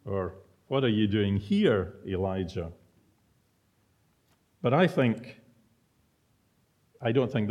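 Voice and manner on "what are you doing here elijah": "here" stressed, then falling on "Elijah". Shown as a demonstration. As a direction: press "here", asking why in this place. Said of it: "depending on where you put the emphasis".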